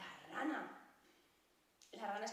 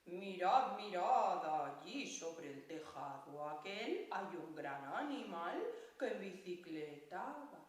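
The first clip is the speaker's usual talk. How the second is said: lower, gruff sleepy-dad voice; deep hedgehog voice; 5 semitones lower, slower than usual. Pretend. The hedgehog sings with a deep voice. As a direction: sing it low like dad in the morning. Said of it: "Erizo tiene una voz muy grave".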